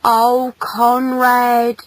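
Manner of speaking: mournful motherly lament; sadly. Acted. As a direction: sigh the name in motherly sorrow. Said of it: "said his mother sadly".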